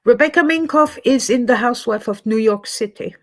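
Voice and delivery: normal talking voice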